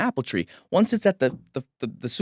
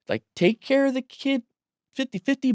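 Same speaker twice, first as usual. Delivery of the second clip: mock whiny complaint; 12 semitones higher than usual. Men's confused complaint quoted.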